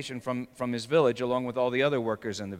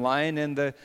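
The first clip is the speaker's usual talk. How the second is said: usual speaking voice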